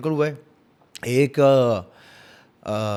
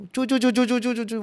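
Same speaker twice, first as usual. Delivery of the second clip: rapid, high-pitched run of squeaks; high chirping footsteps in quick succession; squeaky shoe sound; 7 semitones higher, faster than usual. Retold or imitated. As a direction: imitate shoes scurrying with high squeaks. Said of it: "कोई जूता पहन के नीचे घर में घूम रहा है".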